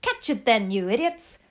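loud, scolding witch's scream; screamed command. A narrator's reading. Grand High Witch to her witches from the platform, screamed command. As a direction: scream at useless underlings. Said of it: "screamed the Grand High Witch".

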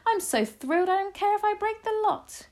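very high, loud elated squeal; thrilled shout. A narrator's reading. Grandmother too thrilled to care, shouting. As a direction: let excitement carry the voice right up. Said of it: "she shouted".

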